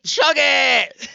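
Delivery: high, loud egging-on yell; imitated rowdy encouragement; mock cheering shout. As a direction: shout it like a drinking chant. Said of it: "she encourages him".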